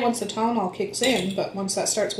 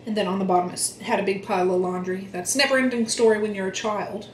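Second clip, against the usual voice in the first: ordinary delivery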